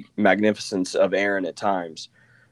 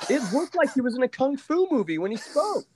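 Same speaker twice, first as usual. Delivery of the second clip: much higher, mocking describer's voice. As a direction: ham it up, squeaky. Describes Colin Farrell character mockingly.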